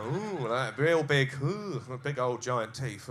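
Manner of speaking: slow, stretched marvelling; slow marvelling at huge teeth; drawn-out emphasis. Acted. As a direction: linger on the words like a big reveal. Describing her teeth, drawn out.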